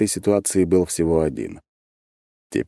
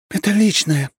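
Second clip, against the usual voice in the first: huffing; 4 dB louder than usual. A narrator's reading. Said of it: "пропыхтела в ответ Анастасия".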